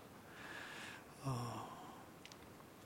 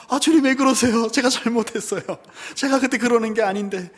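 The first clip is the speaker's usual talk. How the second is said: higher, louder desperate pleading; pleading apology; 5 semitones higher, 7 dB louder than usual. Retold or imitated. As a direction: beg forgiveness in a rush. Peter breaks down apologizing.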